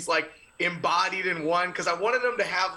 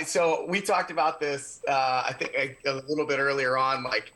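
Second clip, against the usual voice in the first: normal talking voice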